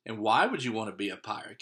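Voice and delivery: plain reading voice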